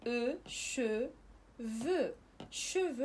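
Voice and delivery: شوفو as "shuvu", slow, final x silent; French شوفو, silent final x. Shown as a demonstration. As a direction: say شوفو with no sound for the x. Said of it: "مكتوبة أيضًا بإكس".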